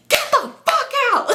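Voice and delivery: very high, loud mock scream; exaggerated furious screech; hammed-up shouting. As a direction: act out screaming him out of the car. Acted ordering him out of car.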